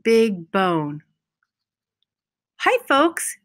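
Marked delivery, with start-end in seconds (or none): 2.6-3.4 cheery puppy. Acted. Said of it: "he said".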